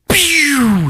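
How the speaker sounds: high sharp pew sound; laser pew. Retold or imitated. Narrator imitating the gauntlet firing, high pew. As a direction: zap it out.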